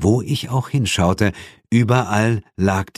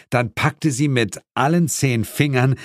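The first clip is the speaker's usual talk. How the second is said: ordinary delivery, nothing marked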